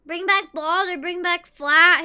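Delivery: ordinary talk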